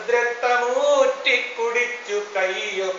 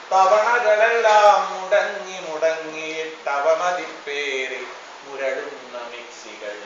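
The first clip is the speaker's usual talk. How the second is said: ordinary delivery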